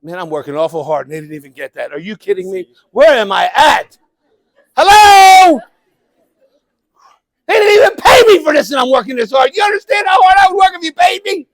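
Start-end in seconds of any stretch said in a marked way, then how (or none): 4.8-11.5 much higher, loud, hammed-up frustration; mock exasperated shout. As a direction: shout in playful despair at the crowd. Mock outrage at silent audience.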